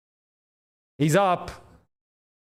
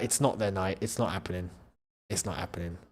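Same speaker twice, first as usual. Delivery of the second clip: much lower and softer, fading away; resigned murmur; 10 semitones lower, 10 dB quieter, faster than usual. Real, not acted. Fan watching Inter lose, quiet resigned murmur. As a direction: let it die on your breath.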